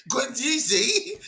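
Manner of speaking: drawn-out mock-Cockney, high and laughing; giggling Cockney mimicry; Cockney accent. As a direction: stretch it slowly in a high Cockney imitation. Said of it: "with this Cockney accent".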